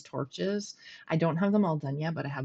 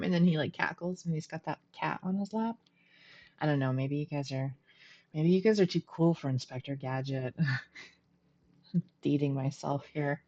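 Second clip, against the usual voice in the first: ordinary delivery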